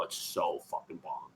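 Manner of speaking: breathy low whisper of delight; hushed awe; whispered aside. Real, not acted. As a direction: drop to a whisper as if sharing a secret. Hushed aside about bacon.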